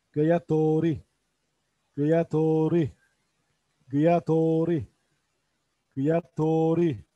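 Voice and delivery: Gıyatori said slowly, syllable by syllable; Urdu pronunciation. Shown as a demonstration. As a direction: model Gıyatori clearly for learners. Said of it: "kabak anlamında". (Gıyatori kelimesini yavaşça heceleyerek söyle)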